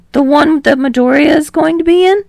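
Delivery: a touch of worried surprise; surprised question. Acted. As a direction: perk up with surprise. Kirishima asks, surprised.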